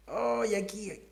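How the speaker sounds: soft pained ay pointing at the spot; a quiet complaint showing where it hurts. Shown as a demonstration. Teacher demos a wrist pain, soft pained ay. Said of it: "si yo digo".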